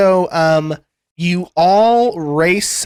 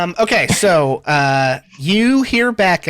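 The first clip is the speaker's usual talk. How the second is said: normal talking voice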